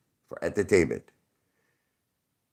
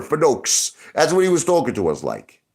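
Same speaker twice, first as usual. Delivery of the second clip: normal talking voice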